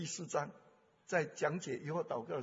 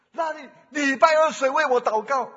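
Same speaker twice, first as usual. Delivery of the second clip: loud plea; 8 semitones higher, 16 dB louder than usual. Retold or imitated. Daughter asks who prayed.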